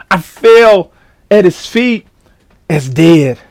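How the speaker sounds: slow scripture reading. Acted.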